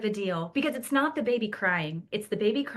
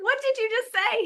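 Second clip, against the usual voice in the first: much higher, incredulous question; shocked mom; 13 semitones higher, 4 dB louder than usual. Retold or imitated. Mom catching her son swearing, high and incredulous. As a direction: ask it half-laughing in disbelief. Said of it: "I said".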